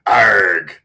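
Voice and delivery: loud pirate "Arg"; pirate growl. Pretend. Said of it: "announce every game in a pirate voice".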